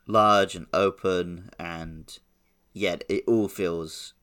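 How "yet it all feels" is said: ordinary delivery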